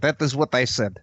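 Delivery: put-on insistent wrestler with a Russian accent. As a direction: answer stolidly, Russian-accented. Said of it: "Just the Russian accent".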